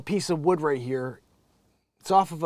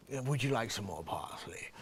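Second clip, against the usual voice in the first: soft, low mocking waiter voice; stupid voice; 5 semitones lower, 9 dB quieter than usual. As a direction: mimic the chef offering, low and soft. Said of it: "he has that stupid type of voice".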